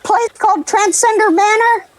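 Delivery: high, squeaky nervous goblin. Acted. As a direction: pitch way up, a timid little goblin. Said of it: "your goblin voice".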